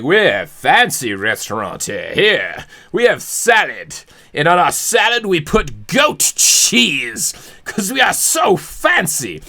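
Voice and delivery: a hint of hammed-up fancy server; fancy restaurant staff. Restaurant staff presenting the salad to a diner, mock fancy. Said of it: "They're like".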